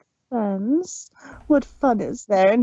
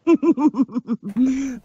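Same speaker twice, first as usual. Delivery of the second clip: villain's mad cackle; deranged laugh. Acted. Discord towering over Twilight, cackling. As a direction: cackle like a madman. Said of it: "a deranged laugh".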